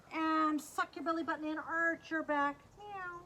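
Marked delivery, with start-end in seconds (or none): 2.8-3.2 soft, high little meow; cat meow. Pretend. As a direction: mew like a cat for kids. Acting a cat in cat pose.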